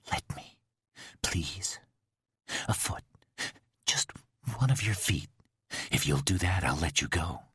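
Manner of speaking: soft, hushed, pleading; whispering. Acted. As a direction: whisper it like a secret. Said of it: "He whispered".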